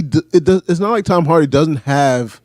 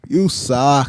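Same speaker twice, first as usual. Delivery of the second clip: mocking imitation, a bit lower; mimicked movie insult; 4 semitones lower than usual. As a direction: copy his goofy delivery of the insult. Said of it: "even the way he said it".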